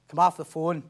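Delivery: ordinary talk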